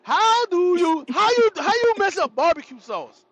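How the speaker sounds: loud, high comic indignation; outraged rant. Retold or imitated. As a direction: shout it in disbelief at the bad cooking. Outraged rant about flavorless chicken.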